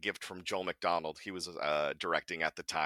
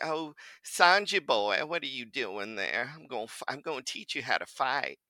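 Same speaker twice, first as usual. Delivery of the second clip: Truman Capote; 5 semitones higher than usual. Acted. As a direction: purr it in a genteel Southern lilt. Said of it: "almost Truman Capote little thing".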